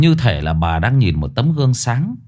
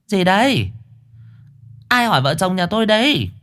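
put-on high, curious old lady voice; high-pitched nosy grandma; nosy old woman; 9 semitones higher than usual. Old woman answers caller.

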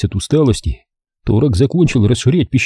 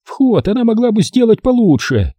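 put-on high, critical old woman; Saeunn's voice; 11 semitones higher than usual. A narrator's reading. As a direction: find fault with her. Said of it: "послышался голос Саюн".

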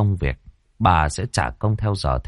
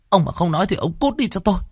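much higher, fast, thrown-out ultimatum; furious ultimatum; 11 semitones higher, faster than usual. Acted. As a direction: repeat the threat, faster and much higher. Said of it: "hỏi to".

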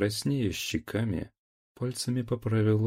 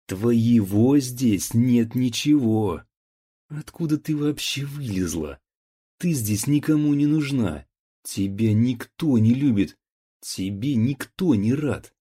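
put-on drunken angry shout; 4 semitones higher, 6 dB louder than usual. A narrator's reading. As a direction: hurl the insults, drunk and furious. Drunk son insults her.

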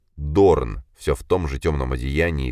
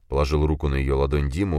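plain reading voice

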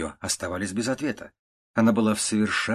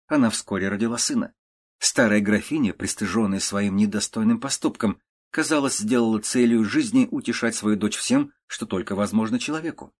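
usual speaking voice

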